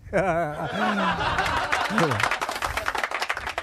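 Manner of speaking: long hearty laugh. Real, not acted. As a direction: laugh at your own joke. Teller laughs after punchline.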